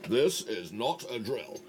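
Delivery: slow, deliberate British warning line; slowly, like a British alarm sample; thoroughly British sample. Shown as a demonstration. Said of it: "I can also do some thoroughly British ones".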